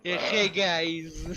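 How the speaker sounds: slow, put-on YouTuber greeting; Andruja impression. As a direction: drag out a mock YouTuber hello. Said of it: "I could not do his impression".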